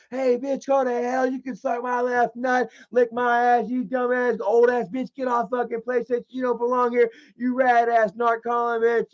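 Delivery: mob of hecklers. Retold or imitated. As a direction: imitate a pile-on of trash-talkers. Imitates everybody chiming in with insults.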